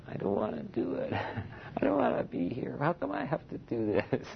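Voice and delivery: high, petulant whining voice; imitating a whining complaint; mock whining. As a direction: whine it like a sulky monk who doesn't want to. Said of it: "come back and whine at the same time".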